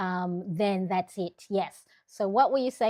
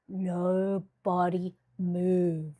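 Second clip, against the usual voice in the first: slow drawn-out command; much slower than usual. Acted. Telling viewers not to move while camera settles, slow drawn command. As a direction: stretch it out, slow and steady. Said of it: "I'm talking slow".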